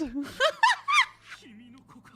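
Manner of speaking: a loud burst of laughter. Real, not acted. Gamer laughing at the mistaken child, loud. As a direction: laugh out at the joke.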